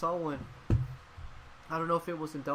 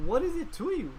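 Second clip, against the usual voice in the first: indignant outburst; 11 semitones higher, 4 dB louder than usual. Real, not acted. Angry rant about racist filming.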